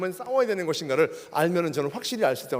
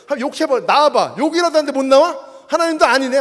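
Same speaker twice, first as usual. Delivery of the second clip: loud, higher taunting challenge; defiant shouting at God; 6 semitones higher, 9 dB louder than usual. Retold or imitated. Angry young man taunting God in church, loud shout. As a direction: dare God to show himself, shouting. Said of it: "욕을 막 하고 있었어요".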